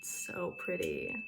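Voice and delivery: low, slow, very soft murmur; hushed admiration. Real, not acted. Vlogger admiring the bell's ring for viewers, hushed awe. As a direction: savor it softly under the ringing.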